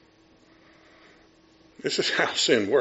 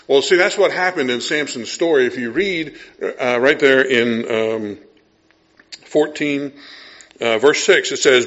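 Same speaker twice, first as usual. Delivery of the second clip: ordinary talk, nothing marked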